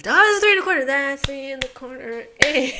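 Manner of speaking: suddenly spotting it; excited realisation. Real, not acted. Solving the puzzle, blurts it out loud and high.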